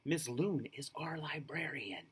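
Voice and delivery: hushed library whisper. Acted. Librarian hides in shelves.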